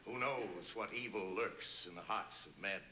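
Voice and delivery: the Shadow. Retold or imitated. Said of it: "I was the shadow".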